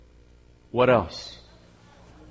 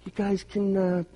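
put-on baby talk; 7 semitones higher, faster than usual. Cooing to newborn baby.

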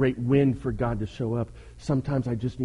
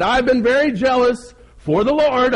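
high, loud, hammed-up complaint; whining; 11 semitones higher, 9 dB louder than usual. Elijah protests his zeal to God, whining loudly. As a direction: whine it loudly to God, pitched way up. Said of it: "and he whined".

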